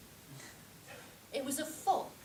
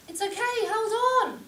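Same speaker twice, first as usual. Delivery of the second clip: raised, high-pitched calling; shouted reassurance; 11 semitones higher, 11 dB louder than usual. Retold or imitated. Woman jumping fences towards the screaming, shouting. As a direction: keep calling out loudly as you go. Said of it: "all this time shouting".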